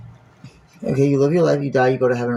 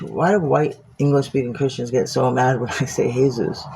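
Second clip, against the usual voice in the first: normal talking voice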